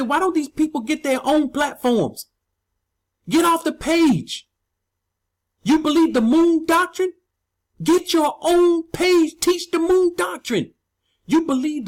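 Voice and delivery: lightly voiced exasperated wife; quoting his wife. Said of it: "My wife said".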